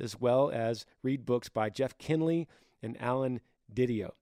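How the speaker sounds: ordinary talk, nothing marked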